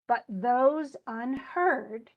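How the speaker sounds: slow, beat-by-beat but those unheard; scanned stresses. Shown as a demonstration. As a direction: tap out each stress slowly. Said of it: "there's a stress, unstressed".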